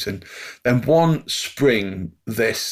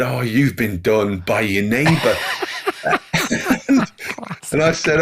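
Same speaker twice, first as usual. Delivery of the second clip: laughing; 2 semitones higher than usual. Retold or imitated. Laughing while quoting the policeman.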